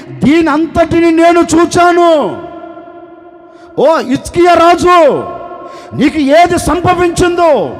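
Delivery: slow, high and loud divine proclamation; voice of God. Acted. God tells the weeping king he saw his tears, slow and booming. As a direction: speak as God from above, grand and unhurried.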